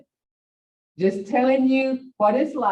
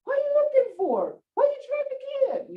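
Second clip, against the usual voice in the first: much higher, pleading fed-up voice; exasperated high question. Retold or imitated. Wife losing patience with husband, high exasperated questions. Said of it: "you get aggravated".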